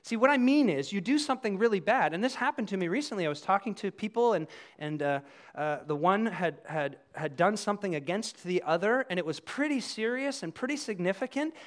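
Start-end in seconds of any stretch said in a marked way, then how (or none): none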